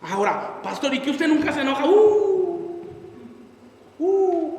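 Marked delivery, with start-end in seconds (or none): 1.9-4.6 high, loud startled hoot; surprised hoot. Real, not acted. Pastor caught out by the question, startled hoot. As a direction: let out a caught-out yelp. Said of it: "¿por qué te ríes?".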